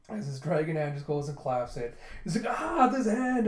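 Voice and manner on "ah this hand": high, loud mock outcry; exasperated yell. Gija ripping his dragon hand off her, exasperated yell. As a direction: cry out in dismay.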